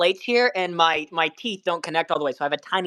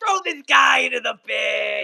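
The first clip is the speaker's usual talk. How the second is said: slow, high hammed-up yell; hammed-up command to ban a chatter; mock shout; 5 semitones higher, slower than usual. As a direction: bellow the order like a fake tyrant.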